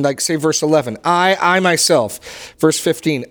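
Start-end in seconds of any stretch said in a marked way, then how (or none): 1.0-2.5 "I, I myself" slow, every word leaned on; slow down and punch "I" and "myself"; emphasis on I myself. Shown as a demonstration. Teacher points the class to God's emphatic "I, I myself", stressed slowly. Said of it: "don't miss the emphasis in".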